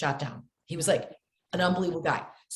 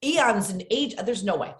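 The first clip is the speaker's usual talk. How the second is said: mock disbelief; 5 semitones higher than usual. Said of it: "you're like".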